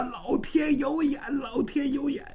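relieved cry. Acted. Father relieved daughter unharmed.

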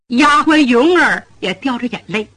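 usual speaking voice

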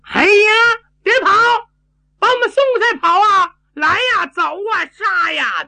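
high-pitched battle yelling after someone; excited, high charge cries; calling out. A narrator's reading. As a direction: holler after a fleeing foe, urging others on. Fighter yells after fleeing enemy.

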